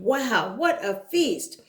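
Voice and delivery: ordinary delivery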